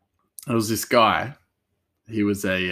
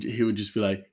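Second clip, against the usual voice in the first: usual speaking voice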